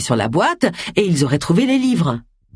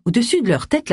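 usual speaking voice

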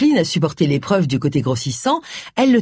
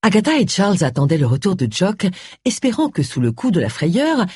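usual speaking voice

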